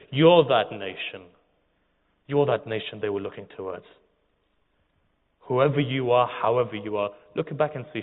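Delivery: preaching at full force; impassioned preaching. Acted. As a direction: drive it home to the congregation. Preacher exhorts the congregation.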